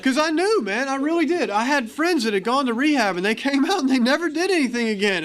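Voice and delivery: bubbling with amused energy; keyed-up laughing storytelling; excited, laughing. Real, not acted. Laughing while explaining the refusal.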